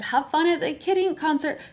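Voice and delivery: imitating them in a high, light voice; high-pitched fake-friendly send-off; mock friendliness. Neighbors seeing the ex off, mock friendliness.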